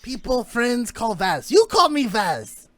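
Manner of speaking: proudly declare, pushing the voice up; Zillock voice. Acted. Zillock insists Granite call him Vaz, slow and loud.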